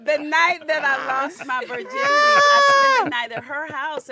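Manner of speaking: loud and high over laughter; shouting over the room. Real, not acted. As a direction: shout to be heard.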